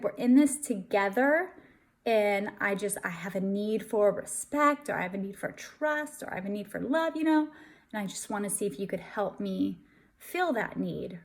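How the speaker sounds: soft, low, gentle request for support; calm, caring. Shown as a demonstration. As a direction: ask calmly and tenderly, as a partner. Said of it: "be very calm, caring, curious, concerned".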